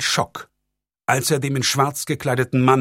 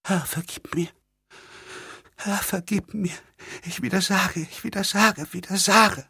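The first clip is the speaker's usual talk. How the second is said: breathless whispered prayer, higher; whispering girl; 8 semitones higher, 5 dB quieter than usual. A narrator's reading. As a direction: whisper the plea under your breath. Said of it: "Das Mädchen begann zu flüstern". (flüsterndes Gebet)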